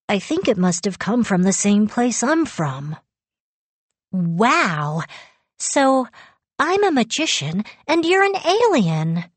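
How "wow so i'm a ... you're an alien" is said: high, marvelling lift; excited wonder. A narrator's reading. Girl marvelling with her alien cousin, excited wonder. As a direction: pitch climbs as the wonder takes hold. Girl thrilled by shared secrets.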